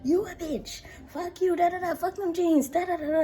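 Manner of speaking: high, loud mock yelling back; mock cussing out. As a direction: fire it right back at them. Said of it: "So I'm like".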